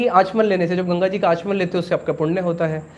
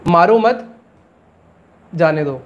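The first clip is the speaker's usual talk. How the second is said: "मारो मत" as one rising unit, pause, then "जाने दो" lower; pause after मत; 3 semitones lower, slower than usual. Shown as a demonstration. Teacher shows students a double-meaning example, pause after "मत". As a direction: break the phrase after "मत", dropping on "जाने दो". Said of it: "dual meaning जैसे कि एक उदाहरण".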